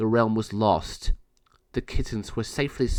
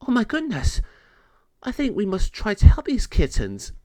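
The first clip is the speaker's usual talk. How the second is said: high, loud fretful voice; dismayed queen; 10 semitones higher, 3 dB louder than usual. Acted. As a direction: gasp then resolve to help. Queen Klupsha reacts.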